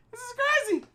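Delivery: squeaky falsetto exclaim; mock squeal of disbelief; high squeal. Real, not acted. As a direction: jump way up into a squeal.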